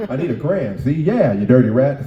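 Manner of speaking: gangster movie voice. Retold or imitated. Mother talking like an old gangster film, loud mock gangster. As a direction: play a movie gangster snarling. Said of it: "like she was a gangster movie".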